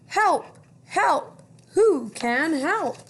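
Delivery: high, loud desperate cries for help; duck crying for help. Acted. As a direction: call out like a panicking stuck duck. Duck calling for help in story.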